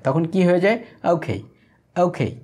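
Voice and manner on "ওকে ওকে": "ওকে" short and light as okhei; quick, run together. Shown as a demonstration. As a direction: say "ওকে" in one quick breath. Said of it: "তখন এটা দ্রুত হয়ে যায়". ("ওকে" দ্রুত, একসাথে)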